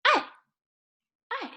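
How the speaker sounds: "I" falling short and steep from high; fourth tone. Shown as a demonstration. Teacher models fourth tone, "I" falling. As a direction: drop "I" sharply downward. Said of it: "and with fourth tone".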